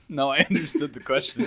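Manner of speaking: laughing while talking; words mixed with laughter. Real, not acted. Laughing at a mix-up.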